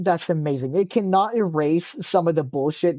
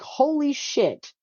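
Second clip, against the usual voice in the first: stunned holy shit; 10 semitones higher, much slower than usual. Retold or imitated. Stunned reaction to mom's words.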